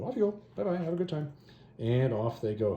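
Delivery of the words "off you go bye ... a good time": high sing-song goodbye; breezy mock send-off. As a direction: shoo them away in a lilting high voice. Retelling her dismissing the priests.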